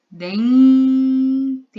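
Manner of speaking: dentes with a loud, long humming n before a separate tes; long held n. Shown as a demonstration. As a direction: hold the n of dentes loudly, then add tes. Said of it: "Olha o som do N".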